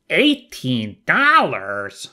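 slow, drawn-out disbelief; hammed-up incredulous question. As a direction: stretch it out in acted astonishment. Re-performs own game line.

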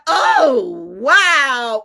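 high and loud mock-amazed exclamation; impressed daddy. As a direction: play the impressed husband, big and loud. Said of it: "something my daddy or my husband would do".

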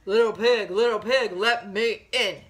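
loud, measured chanted demand; a hint of wolf, punching each beat; lightly voiced big bad wolf. Wolf knocks on piggy's door, loud chanted demand. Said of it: "And he says".

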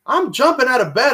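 ordinary talk, nothing marked

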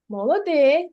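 usual speaking voice

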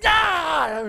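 sudden loud high yelp; startled electric-shock yelp. Retold or imitated. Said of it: "you go".

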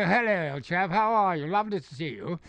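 British voice. Acted. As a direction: imitate an old-fashioned British gent hailing a chum. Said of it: "Americans didn't like the British voice".

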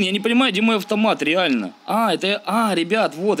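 ordinary delivery

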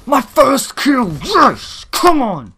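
loud, high celebrating cry; triumphant cheer. Real, not acted. Gamer celebrating a first kill, triumphant cheer.

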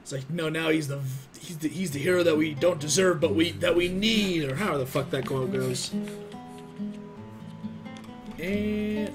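ordinary talk

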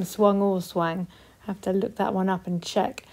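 ordinary delivery

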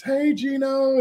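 high sing-out greeting; imitated calling out. Beachgoers greet the star player walking by, imitated high call.

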